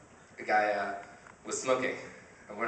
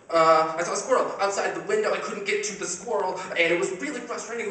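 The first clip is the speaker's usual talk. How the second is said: higher, louder goofy dog voice; dog's voice; 8 semitones higher, 5 dB louder than usual. Retold or imitated. Owner voicing his dog complaining about a squirrel. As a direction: whine like a frustrated dog.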